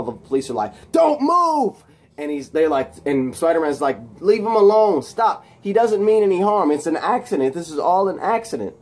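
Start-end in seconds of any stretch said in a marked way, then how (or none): 0.9-1.8 high, loud mock command; shouted police order. 4.2-8.8 raised, high-pitched plea, easing toward the end; urgent protest. Retold or imitated. Spider-Man pleads with police.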